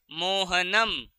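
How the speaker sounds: मोहनम with a clear closing m sound; slow demo of the m ending; final म. Shown as a demonstration. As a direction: say मोहनम slowly, landing on the final m. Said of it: "उसका हम म उच्चारण करते हैं".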